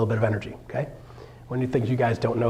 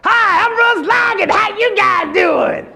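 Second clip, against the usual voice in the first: much higher, louder, slow shouted intro; way too much; 9 dB louder, much slower than usual. Shown as a demonstration. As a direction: blow it out like a game show host. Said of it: "Little more bump".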